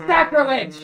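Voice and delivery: high, loud, drawn-out cry of horror; hammed-up scandalized protest; gasp, then cry out as if appalled; mock outrage. Mock outrage at wearing a dress.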